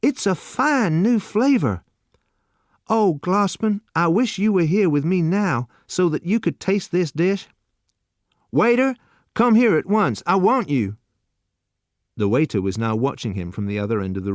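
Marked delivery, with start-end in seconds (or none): 0.0-11.0 overflowing joy; rapturous youth. A narrator's reading. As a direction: youth calling the waiter over in delight. Rapturous then calling waiter.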